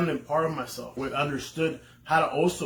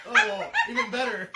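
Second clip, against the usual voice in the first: much higher, sarcastic falsetto squeak; mock sarcasm. Husband mocking his wife's excuse in a high sarcastic squeak.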